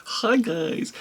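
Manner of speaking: high, chirpy YouTuber greeting; perky vlogger hello; bubbly. Pretend. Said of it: "I'm too old for this game".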